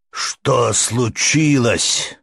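gasping out the question; out of breath. Acted. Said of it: "Перкен, задыхаясь".

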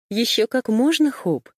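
bright, merry firefly voice; cheerful ringing voice. Acted. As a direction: ring it out playfully. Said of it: "весело прозвенел светляк".

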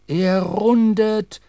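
slow, high, loud call; ringing exclamation. A narrator's reading. Crewman calling out that the whale sounds, drawn-out cry. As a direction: let the cry ring out drawn-out. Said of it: "ertönte der Ausruf".

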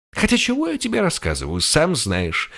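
smug, knowing smirk. A narrator's reading. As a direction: say it with a sly grin. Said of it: "ухмыльнулась она".

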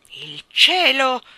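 loud, slow and booming; king thundering at the cat. Acted. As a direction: boom it out like a king. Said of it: "tuonò la sua voce".

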